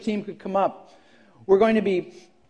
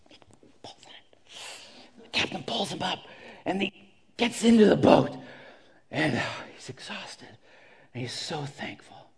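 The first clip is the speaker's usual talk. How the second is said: much softer, near-whispered telling; hushed narration; 3 semitones lower, 6 dB quieter than usual. A narrator's reading. Preacher hushing the story of the rescue, whispered. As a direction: drop to a hush for the rescue.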